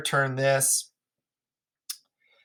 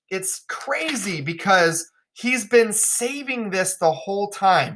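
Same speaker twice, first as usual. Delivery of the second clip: usual speaking voice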